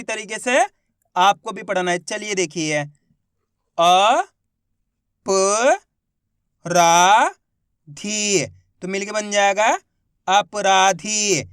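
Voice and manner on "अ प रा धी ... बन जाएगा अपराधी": slow, loud, one syllable at a time, then the whole word drawn out; patient teacher spelling aloud; syllable-by-syllable reading drill. Shown as a demonstration. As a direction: sound out each syllable clearly for a child, then blend it slowly. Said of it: "मेरे तरीके पे गौर करना है".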